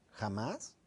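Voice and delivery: a low, quiet echo of the word jamás; low questioning tone. Shown as a demonstration. Coach echoing a client's absolute word in a low, doubting tone. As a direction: repeat "jamás" back low and soft, doubting it. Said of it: "con un tono bajo".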